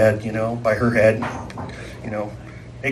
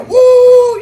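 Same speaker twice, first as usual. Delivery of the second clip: high whooping shout; the crowd cheering back at the singer; 6 dB louder than usual. Retold or imitated. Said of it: "and they're".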